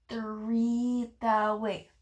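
usual speaking voice

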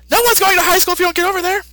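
high, fast scolding voice; old Kai. Retold or imitated. Old Kai hurrying Goku along, high and fast. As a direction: snap at him in a squeaky rush. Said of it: "the old Kai says".

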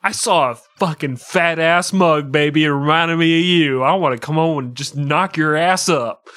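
loud, brash paraphrase of a love letter; loud crude mock declaration. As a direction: shout it like a lusty husband, hammed up. Crude paraphrase of the mug quote.

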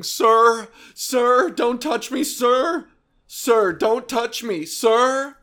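a jittery filmer backing off; imitating nervous bystander. Person filming a meltdown begs a man to stay back, high and nervous. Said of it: "somebody behind a camera going".

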